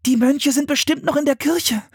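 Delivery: put-on very high child's voice; high, eager little girl; girl's sudden realisation. A narrator's reading. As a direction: blurt out the idea. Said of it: "fiel Anne ein".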